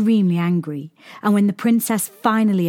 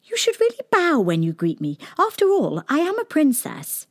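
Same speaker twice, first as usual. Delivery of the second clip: haughty princess; 8 semitones higher than usual. A narrator's reading. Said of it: "She smiled back and said".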